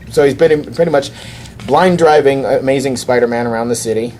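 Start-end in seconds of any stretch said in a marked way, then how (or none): none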